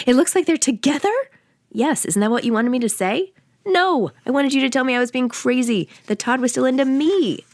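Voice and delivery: a lightly voiced upset fifteen-year-old girl; indignant teen daughter. A narrator's reading. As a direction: echo it back in disbelief as a hurt teen.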